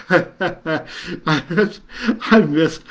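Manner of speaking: laughing. Real, not acted. Laughing while reading comments.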